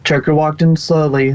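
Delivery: usual speaking voice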